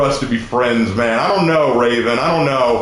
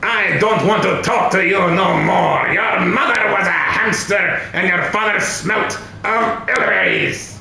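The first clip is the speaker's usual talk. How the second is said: higher, hammed-up French accent; outrageous French accent; 4 semitones higher than usual. Taunting after being asked to try French, outrageous French accent. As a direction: ham up a French insult. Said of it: "this outrageous accent".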